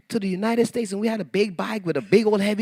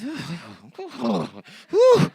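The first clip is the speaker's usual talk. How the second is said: overwhelmed oohs; slower than usual. Retold or imitated. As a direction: reenact being floored by a gift. Overwhelmed by the gift, retold.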